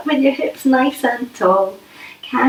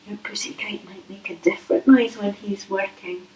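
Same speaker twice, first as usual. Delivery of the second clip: soft whispered aside; quiet unvoiced aside; 6 dB quieter than usual. Shown as a demonstration. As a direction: hush it to the children.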